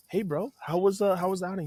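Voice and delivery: upbeat greeting. Retold or imitated. Coach greeting his young pitcher, upbeat and high-pitched.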